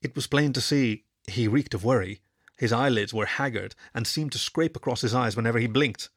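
usual speaking voice

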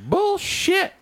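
high, indignant "bullshit"; angry shout. Retold or imitated. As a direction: bark it in disbelief. Angry neighbor voiced in story.

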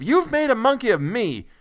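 put-on father fiercely snapping back at his old son; fierce retort. A narrator's reading. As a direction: hurl it back in a fury. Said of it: "retorted Mister Button fiercely".

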